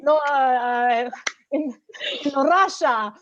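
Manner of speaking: mock Russian accent, very slow broken English; exaggerated slow Russian accent. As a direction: ham up a Russian accent, dragging words. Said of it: "my Russian accent was pretty good".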